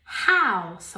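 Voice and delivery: House with a rasped /x/ H; H like fogging glass; harsh H. Shown as a demonstration. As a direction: House con H raspada. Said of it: "si limpiaras un cristal".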